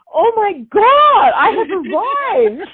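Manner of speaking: slow, very high and loud mock triumph; thrilled. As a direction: draw it out like a star arriving. Thrilled her sign is famous.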